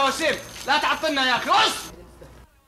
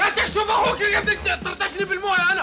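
high shouting complaint with laughs; laughing shout; 5 semitones higher than usual. Acted. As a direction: yell at the neighbour to stop his brother. Soaked, shouting to his neighbour. (صراخ ضاحك عالي)

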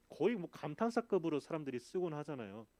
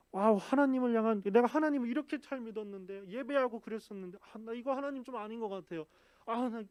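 raised, louder voice of protest against God; indignant complaint; 6 semitones higher, 5 dB louder than usual. Pretend. Pastor voicing Job's imagined grievance, raised and indignant.

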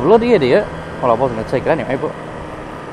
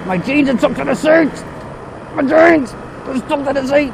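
high-pitched comic wail; exclaiming dismay; 10 semitones higher than usual. Real, not acted. Rider wailing about stuck jeans, exclaiming in dismay. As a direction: yelp it, high and strained.

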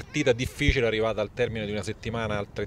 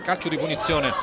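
loud, high live commentary; excited play-by-play; 9 semitones higher, 9 dB louder than usual. Real, not acted. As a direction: call the last chances urgently. Final minutes live commentary.

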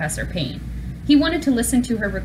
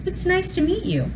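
higher, gentle professor voice; warm high voice; 11 semitones higher than usual. A narrator's reading. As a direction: welcome him kindly, pitched up. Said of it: "in a warm, high voice".

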